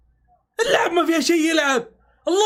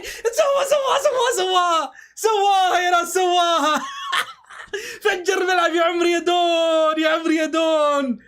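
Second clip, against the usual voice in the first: laughing, shouted celebration; real joyful goal shout. Real, not acted. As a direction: explode with joy at the goal.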